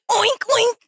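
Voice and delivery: high-pitched mock oinking; pig sound. Shown as a demonstration. Guest showing their mistaken pig calling, high oinks. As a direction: squeak out silly pig oinks. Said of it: "I thought it was like a pig sound".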